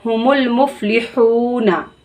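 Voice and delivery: हुमुल मुफलिहूना with a throat हा and long हू; slow, throat ha and stretched हू; careful recitation of मुफलिहूना; throaty drawn ha. Shown as a demonstration. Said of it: "हलक से निकाल के पढ़ेंगे".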